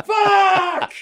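frustrated yell. Retold or imitated. Yelling out car window.

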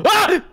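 short, high yelp of fright; acted frightened cry; startled yelp. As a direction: yelp as if crept up on. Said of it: "He yelps in fear".

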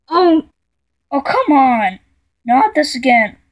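mock exasperation. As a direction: groan out a loud complaint.

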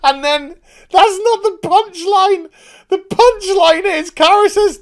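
high, loud, cracking up; real hilarity. Real, not acted. Reviewer getting to the punchline, real hilarity. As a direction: squeal through the joke, barely holding together.

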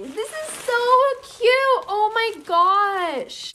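high-pitched, drawn-out squeal of adoration; swooning, high and stretched; gushing delight. Real, not acted. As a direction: gush over something adorable. Gushing over a cute gift.

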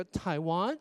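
台湾 said as English "Taiwan", WAN rising; questioning 台湾, lifting the stressed second syllable. Shown as a demonstration. Teacher showing a correct question, 台湾 rising on WAN. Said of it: "拉高那个重音".

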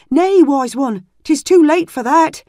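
put-on high, loud anguished boy; distressed young squire. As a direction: break the news in anguish. Squire voices bad news.

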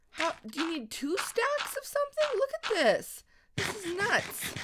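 high-pitched disbelief; astonished. Real, not acted. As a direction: pitch shoots up in amazement. Astonished at what is seen.

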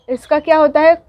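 ordinary talk, nothing marked

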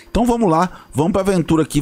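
usual speaking voice

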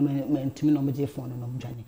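ordinary delivery

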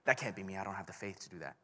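doubtful inner voice. Retold or imitated. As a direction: mutter it like a quiet doubt. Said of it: "because you're like".

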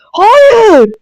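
high, loud anguished yell of no; real shout. Real, not acted. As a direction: scream it out in panic. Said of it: "o kadar bağırdım ki".

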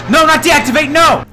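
high, loud pleading yell; frantic high-pitched protest; panicked shout. Real, not acted. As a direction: yell it in alarm. Bodyguard bot shuts off.

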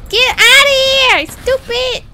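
loud, high shout at the enemy; angry yell. Real, not acted. Player shouting at a game enemy, real loud yell. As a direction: bark it out in a panic.

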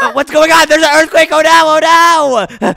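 high, loud acted panic; panicked shouting. Friend caught in a supposed earthquake, panicked shouting. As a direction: yell in alarm as everything shakes.

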